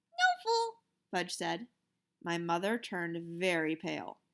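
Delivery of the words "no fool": put-on very high little-kid voice; lightly voiced toddler answering his brother. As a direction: squeak it like a toddler.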